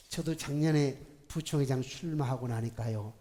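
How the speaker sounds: normal talking voice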